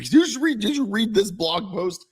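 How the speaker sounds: breathless eager question to his wife; excitedly asking his wife if she read it. Retold or imitated. Excited retold question to Jill.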